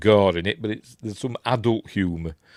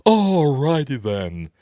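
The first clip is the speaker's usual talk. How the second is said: drawn-out, higher catchphrase impression; Ace Ventura; 5 semitones higher, 4 dB louder, slower than usual. Showing viewers what everybody does, imitating Ace Ventura. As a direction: stretch it out high, hammed-up. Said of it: "Everybody tries to do an impression of Ace Ventura".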